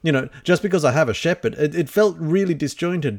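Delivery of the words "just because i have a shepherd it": ordinary talk, nothing marked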